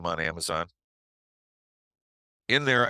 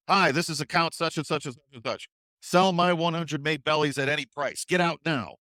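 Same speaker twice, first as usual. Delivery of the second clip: urgent order; 6 semitones higher than usual. Retold or imitated. Urgent sell order on phone.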